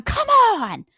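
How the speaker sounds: high, loud sideline yell; exasperated fan yelling come on; yelling at refs. Retold or imitated. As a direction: shout it at the bad call. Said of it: "but I'm down there going".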